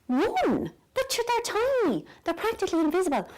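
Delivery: high-pitched disbelief, swooping down on TINY; incredulous. Retold or imitated. Recalling her reaction to the tiny dose, incredulous. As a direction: exclaim it in high, mock-outraged disbelief. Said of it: "And I thought".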